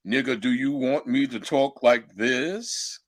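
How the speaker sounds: slow, deliberate put-on voice; imitated careful talk. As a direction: imitate someone spelling out each word slowly. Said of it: "cannot understand her through her accent".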